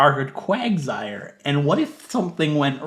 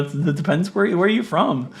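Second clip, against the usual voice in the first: ordinary talk, nothing marked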